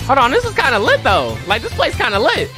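excited. Real, not acted. Hyped by the game's music.

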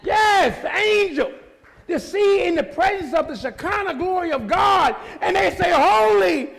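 high, loud, shouting sermon; preaching at full force. Acted. Preacher to the congregation, full shouting force. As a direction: lift the room, preach it hard.

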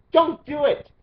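sharp high yell; shouted plea. Real, not acted. Vlogger yelling at viewers not to sign, high and loud. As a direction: bark it out at the viewers.

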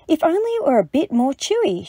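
high-pitched, mild storybook sigh; a touch of wistful sighing. A narrator's reading. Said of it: "she sighed".

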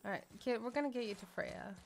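ordinary delivery

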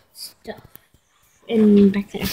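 whispering. Real, not acted. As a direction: whisper it under your breath. Whispering aside to camera.

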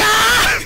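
high laughing yelp of a scream; a quick shrieked ah through laughter; demo death scream. Shown as a demonstration. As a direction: show off the panic scream, half laughing. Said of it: "The way I scream whenever I'm about to die".

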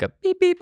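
high imitated car beep; imitating a polite double honk. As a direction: chirp the horn sound. Imitating a horn.

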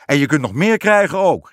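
loud, high-pitched threat; angry call. A narrator's reading. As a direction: shout back in a temper. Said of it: "riep de koning".

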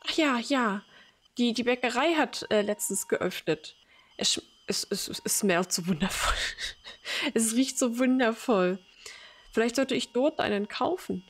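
put-on elderly mother voice. Old mother greeting her grown son as little Timmy, doting. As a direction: greet him like a fond, confused old mum.

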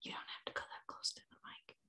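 unvoiced hushed aside; whispering. Real, not acted. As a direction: whisper it off-mic. Aside to child near mic.

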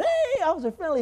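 put-on high, loud call of a child across a ballpark; a kid yells to the officer at a baseball game, excited call.

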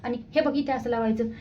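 high, coaxing mum voice; mother coaxing her crying daughter during nail paint. Real, not acted. Mother soothing crying baby.

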